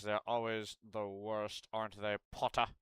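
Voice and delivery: slow, lightly voiced Snape drawl; a hint of Snape sneering at Potter; Snape impression. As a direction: languid mock-teacher drawl, half-serious. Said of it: "I got a good Snape".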